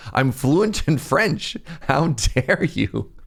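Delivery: hammed-up outrage, word by word; mock indignation. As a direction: imitate an offended woman, drawn out for effect. Acts out offended Quebecois woman.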